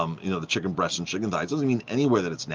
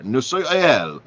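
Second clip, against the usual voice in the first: higher, louder mock French waiter; French accent; 6 semitones higher, 5 dB louder, slower than usual. French waiter assuring a diner, emphatic French accent. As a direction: insist on it in a put-on French accent. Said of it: "So the waiter goes in this French accent".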